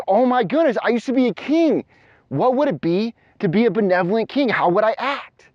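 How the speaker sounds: amazed excitement. Acted. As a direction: lift up with wonder at the news. Learning he was a king.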